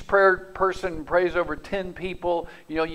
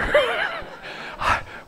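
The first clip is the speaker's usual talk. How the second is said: high burst of laughter. Real, not acted. Pastor laughing at his own story. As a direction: laugh it off lightly.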